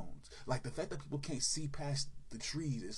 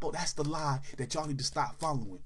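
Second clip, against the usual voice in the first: ordinary talk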